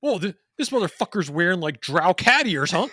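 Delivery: high, loud mocking rant; mock raging gamer. Friend mimicking Slacks mocking a player, loud and high. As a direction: sneer at the teammate's cat ears. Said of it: "it's like".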